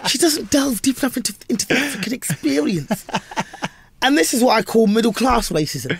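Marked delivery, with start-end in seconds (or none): 0.1-3.0 high-pitched lofty reviewer voice; mocked critic. Mocking the Guardian critic's two-star verdict, high and pompous. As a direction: mimic the critic with exaggerated pomposity. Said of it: "That was what he wrote".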